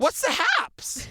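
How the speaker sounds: slow, high drawn "what's the haps"; catchphrase. Pretend. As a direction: sell the silly catchphrase. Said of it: "trying to make that a thing".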